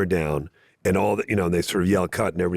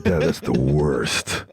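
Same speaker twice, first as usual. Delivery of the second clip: slow, lower mock whine of teenage kids; mock groaning kids; 8 semitones lower, much slower than usual. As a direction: drag it out like embarrassed kids. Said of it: "They're like".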